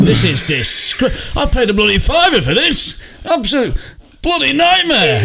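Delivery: very high, spluttering overblown indignation; mock outrage. Passengers complaining about a delayed cheap coach, hammed-up outrage. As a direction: splutter it like a scandalised customer. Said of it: "obviously we're outraged".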